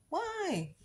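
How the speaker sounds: put-on high, small childish "why"; child's whine. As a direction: voice the clueless kid. Child answering parent.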